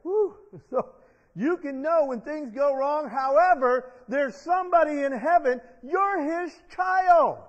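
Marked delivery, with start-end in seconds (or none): 5.8-7.4 slow and louder, higher; preacher's comfort; tender preaching. Real, not acted. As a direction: stretch it out to reassure them.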